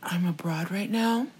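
quiet, slow, halting mock apology; dry-throated vlogger imitation. Mocking his apology video to co-host, dry-throated. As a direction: softly mimic a dry-mouthed apology video. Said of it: "the way he's talking, he's like".

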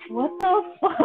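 high, laughing disbelief; shocked laugh. Real, not acted. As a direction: blurt it out while laughing. Shocked at the joke.

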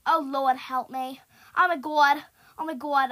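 loud, frantic cries for help; panicked shouting. Real, not acted. Gamer chased by three, panicked shouting. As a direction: shout out in alarm, line after line.

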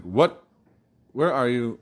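imitating an exasperated phone call; retold exasperation. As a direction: demand answers, voice jumping up.

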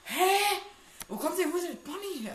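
a yelp of real fright and disbelief; startled shriek. Real, not acted. Gamer shrieks as Bonnie appears out of nowhere, startled.

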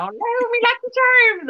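high-pitched hammed-up Irish leprechaun; leprechaun Irish accent. Shown as a demonstration. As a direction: squeak out a cartoon Irish brogue. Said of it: "I sound like a leprechaun".

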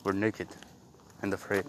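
low, soft mock Indian voice; mock Indian accent. Mocking friend's impression of Egbert, low mock Indian accent. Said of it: "Indian accent".

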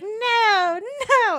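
high-pitched mock protest; tickled woman. Woman being tickled by Dan, mock protest in high voice. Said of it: "like, tickling her".

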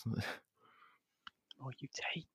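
soft whispered riddle; whispering. Acted. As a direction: whisper it under the breath.

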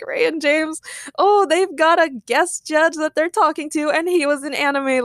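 high-pitched starstruck fan. Retold or imitated. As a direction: gush about a celebrity guest.

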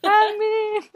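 high, loud cheering call; mock cheer. Imitating fans shouting his name. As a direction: yell his name like a fan.

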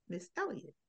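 cold. Retold or imitated. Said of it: "he says".

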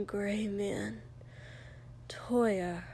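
soft mutter fading to a whisper. A narrator's reading. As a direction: mumble it, trailing into breath. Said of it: "Shoto muttered".

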